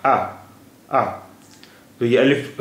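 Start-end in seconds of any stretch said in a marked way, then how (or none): 0.1-1.0 आ as a plain open long a, like the Hindi vowel; alif vowel. Shown as a demonstration. Teacher shows the Hindi आ sound to learners. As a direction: say आ open and clear, no catch in the throat. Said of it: "Hindi का आ है".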